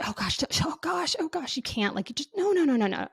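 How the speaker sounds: high, breathless panicked denial; panicked whisper. Retold or imitated. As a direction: whisper in panic, then rising no's. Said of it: "she was like".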